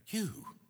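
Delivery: You pitched high and drawn out; single out the listener with a high You; stress on You. Shown as a demonstration. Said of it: "put the emphasis on the word you".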